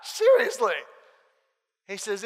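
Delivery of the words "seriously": higher, louder insistent yeah; emphatic mock-serious yes, seriously. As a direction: insist it loudly, pitch up. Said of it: "I'm like".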